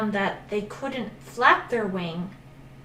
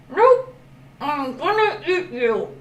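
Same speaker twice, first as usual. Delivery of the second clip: gloating crocodile; 6 semitones higher, 3 dB louder than usual. Acted. Crocodile gloats over prey.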